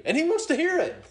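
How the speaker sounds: a laugh breaking into the line. Real, not acted. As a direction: chuckle through the words. Preacher laughing at his own point.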